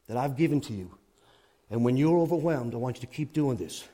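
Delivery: low, soft, intimate preaching; gentle divine appeal. Acted. God inviting the overwhelmed to trust him, gentle appeal. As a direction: speak God's invitation softly, with long pauses.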